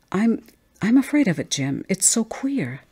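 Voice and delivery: high, halting confession of fear; afraid. Acted. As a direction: stammer the start, then plead. Wife confesses fear to husband.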